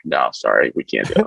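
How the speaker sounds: a lightly voiced label rep turning them down; mock label rep. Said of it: "and they're just like".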